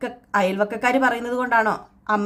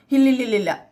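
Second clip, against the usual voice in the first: raised voice; 3 semitones higher than usual. Retold or imitated. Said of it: "ഈ ഇങ്ങനെ സൗണ്ടിൽ പറഞ്ഞപ്പോഴത്തേക്കും".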